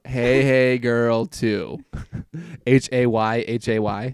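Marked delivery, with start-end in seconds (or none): 0.0-1.8 very slow, drawn-out teasing echo; teasing repeat. Retold or imitated. Host echoing friend's old screen name, slow and teasing. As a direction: stretch every word in mock disbelief.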